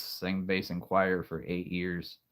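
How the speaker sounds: ordinary delivery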